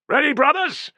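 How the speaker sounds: high, loud rallying call. Acted. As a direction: call the men to ready. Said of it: "called Tullus".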